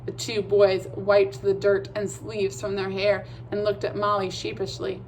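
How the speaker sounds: ordinary delivery, nothing marked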